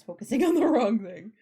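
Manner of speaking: loud laugh breaking through the words; laughing at the wrong focus. Real, not acted. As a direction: laugh at the stubborn camera mid-sentence. Laughing at the camera.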